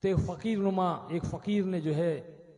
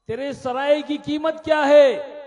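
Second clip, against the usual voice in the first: higher and much louder, calling the king out; loud challenge; 7 semitones higher, 11 dB louder than usual. Acted. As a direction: beggar boldly demands the price. Beggar confronts the king.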